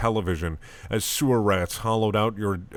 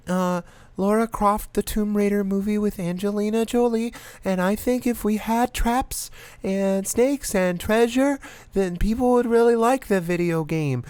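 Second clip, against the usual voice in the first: put-on little kid voice; 11 semitones higher than usual. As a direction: play an eager little girl pitching ideas. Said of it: "brought their kid".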